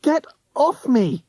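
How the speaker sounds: very high shriek. A narrator's reading. Tessa fighting off De Sniff, high shriek. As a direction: shriek it while struggling. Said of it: "she shrieked".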